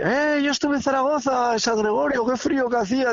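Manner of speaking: high, chatty voice of a stranger reminiscing; retold ex-soldier. A stranger telling him about military service in Zaragoza, high and chatty. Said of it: "Y dice".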